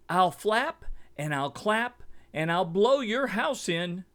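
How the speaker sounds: loud, high squawk, punching flap and blow; squawking eagle. A narrator's reading. Eagle squawking threats at Mimby's grass house. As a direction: squawk the threat like a greedy bird. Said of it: "he squawked".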